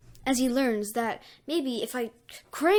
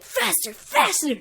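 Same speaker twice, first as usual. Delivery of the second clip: high, rising yell of faster; frantic cheering yell; excited yell; 10 semitones higher, 4 dB louder, slower than usual. Acted. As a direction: shout it, straining higher. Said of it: "Deku going, like".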